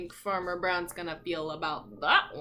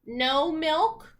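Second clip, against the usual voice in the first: put-on reading to children; 2 semitones higher, 4 dB louder, slower than usual. A narrator's reading. Reads picture book aloud.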